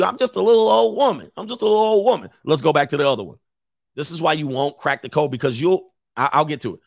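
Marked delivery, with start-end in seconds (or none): 0.0-2.3 put-on high, pleading little old woman; mock a helpless little old lady. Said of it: "They do this to you".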